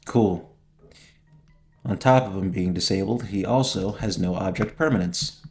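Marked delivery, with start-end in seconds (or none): none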